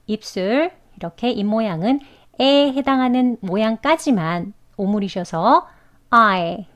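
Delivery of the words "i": I said as ah gliding to eh; diphthong I demo. Shown as a demonstration. Teacher modelling the I diphthong for learners. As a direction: open ah sliding into a short eh for I. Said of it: "I 이렇게 발음하시는 연습을".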